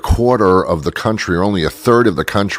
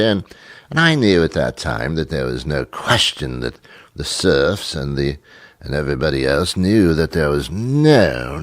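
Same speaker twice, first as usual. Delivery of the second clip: mock upper-crust British accent. Host showing that anything said sounds knowledgeable, hammed-up British accent. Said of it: "if you have a British accent".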